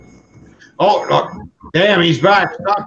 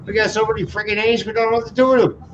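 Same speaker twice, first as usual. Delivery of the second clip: higher, hammed-up Canadian with eh at every end; exaggerated Canadian accent, pitched up; mock Canadian; 6 semitones higher than usual. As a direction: play a jolly Canadian offering beer. Mocking Canadian with repeated eh.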